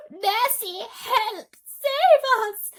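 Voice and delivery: high, loud panicked cry for help; frightened cry. Acted. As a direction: shriek it in terror. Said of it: "cried the ladybird".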